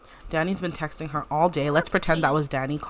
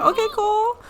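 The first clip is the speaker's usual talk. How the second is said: excited call; 5 dB louder, faster than usual. Real, not acted. Calling a character.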